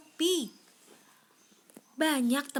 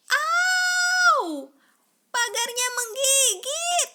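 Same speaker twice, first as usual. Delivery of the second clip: high, loud yelp of pain; 14 semitones higher, 9 dB louder, much slower than usual. A narrator's reading. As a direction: cry out as if bitten. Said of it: "jerit teman Yaki". (jeritan kesakitan yang tinggi dan keras)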